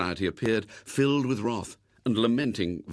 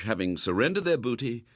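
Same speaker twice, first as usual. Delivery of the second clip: plain reading voice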